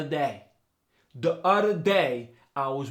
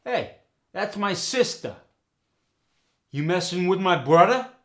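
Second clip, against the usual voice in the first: higher, louder New York accent with dropped Rs; 5 semitones higher, 5 dB louder than usual. Shown as a demonstration. New Yorker confronting someone about a sibling, in New York accent. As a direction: challenge someone in New York dialect, sistuh, brothuh. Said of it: "In New York".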